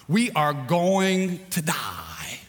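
a slow, hammed-up wail of doom; drawn-out panicked wail. Shipwrecked man panics to his companion, slow mock wail. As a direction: stretch it out slowly in mock terror. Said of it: "ran all over the island screaming".

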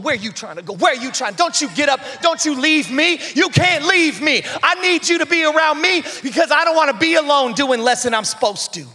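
a mild, needy plea not to leave; clingy people. Acted. Voicing people who hold you back.

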